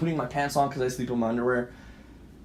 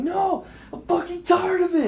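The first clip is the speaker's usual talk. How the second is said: very high, upset outburst; pitched-up re-enacted yell; retold screaming. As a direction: refuse to be calmed, crying out. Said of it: "I'm just screaming and yelling".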